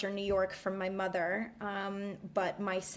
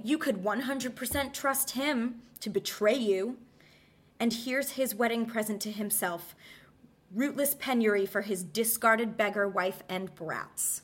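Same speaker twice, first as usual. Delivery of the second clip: scornful, raised theatrical monologue; Medea's bitter speech; 3 semitones higher, 4 dB louder than usual. Acted. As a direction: hurl it at Jason like a betrayed queen. Dramatic Medea monologue read.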